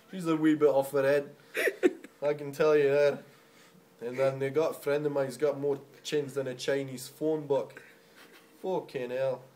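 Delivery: Scottish storyteller's accent. Shown as a demonstration. Comic teasing friends, Scottish accent. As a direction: joke along in a Scottish accent. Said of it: "if you go for a Scottish accent".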